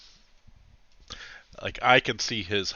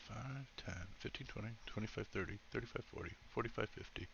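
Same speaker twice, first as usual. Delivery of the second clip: counting under the breath; quiet counting to self; muttered counting; 17 dB quieter than usual. Real, not acted.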